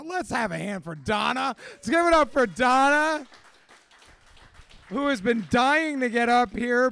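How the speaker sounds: rally the room to cheer her on; calling out. Real, not acted. Host rallying the audience for a shy speaker, loud call. Hyping the crowd for Donna.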